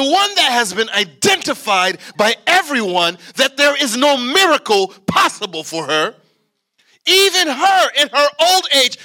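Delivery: high, loud climactic preacher's cry; full-force preaching. Real, not acted.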